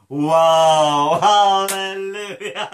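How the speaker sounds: drawn-out hallelujah breaking into high laughter; real, elated belly laugh; jubilant laughing. Real, not acted. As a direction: burst into joyous laughter. Rejoices after the salvation prayer.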